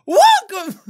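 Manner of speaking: high, loud redo of the word; shouted welcome. Acted. Redoing the welcome for the camera, loud and high. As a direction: punch it out big. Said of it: "how my voice breaks".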